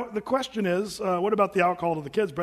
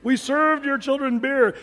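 freaking out; 5 semitones higher, 6 dB louder, slower than usual. Retold or imitated. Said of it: "just totally freaking out".

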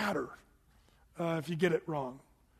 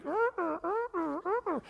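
put-on little girl; 11 semitones higher than usual. Little daughter playing with windows.

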